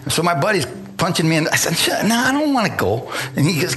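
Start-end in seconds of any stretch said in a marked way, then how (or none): 1.8-3.1 reluctant refusal. Retold or imitated. Reluctant refusal to pushy buddy.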